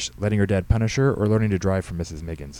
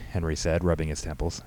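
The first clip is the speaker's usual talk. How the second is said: ordinary delivery, nothing marked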